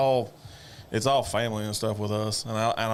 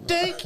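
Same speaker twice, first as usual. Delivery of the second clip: imitated bat crack.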